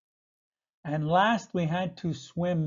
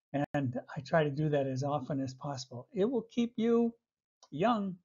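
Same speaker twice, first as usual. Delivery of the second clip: usual speaking voice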